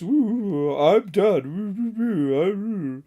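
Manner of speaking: silly put-on man's voice; hammed-up fake deep voice; mock deep voice. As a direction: imitate someone faking a deep voice. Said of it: "like, a deep voice".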